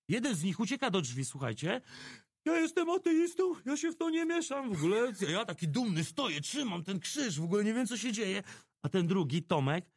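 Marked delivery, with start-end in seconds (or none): 2.4-5.1 high, panicky voice of a fleeing tough guy; scared thug. Retold or imitated. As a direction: squeak it out while backing toward the door.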